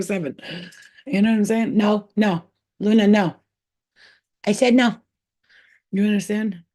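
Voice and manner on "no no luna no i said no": sharp, loud scolding of a pet, higher pitched; firm no to a misbehaving cat. Real, not acted. Owner scolds her cat Luna, loud and sharp.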